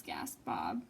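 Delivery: breathy whisper of gasped Bob; hushed gasping narration. A narrator's reading. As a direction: whisper it in shock.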